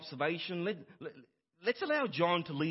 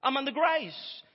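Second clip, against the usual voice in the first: a complaining churchgoer, louder and higher; mock whiny objection; 5 semitones higher, 5 dB louder than usual. Imagined congregant whining back at the pastor, mock complaint. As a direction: whine back at the preacher in mock protest. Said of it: "before we begin to whine again and say".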